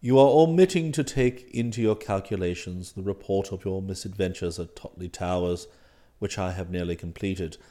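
low, soft, formal manservant voice; unruffled valet. Acted. Valet explains club rules to master, unruffled. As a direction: explain deferentially, deep and composed.

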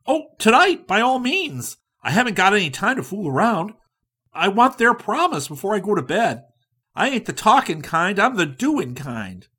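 high, loud and hurried; no time to fool around; impatient go-getter. A narrator's reading. Likens insisting on tonight, high and hasty.

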